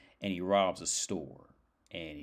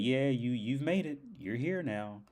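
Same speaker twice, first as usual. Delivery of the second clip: guide's voice lifted a little higher; lightly voiced afterlife guide; 6 semitones higher than usual. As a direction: lightly play the welcoming guide.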